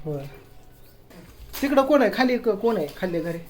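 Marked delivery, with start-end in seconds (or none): 1.6-2.4 raised, louder call across the house. Real, not acted. Father calls to family downstairs, raised and loud.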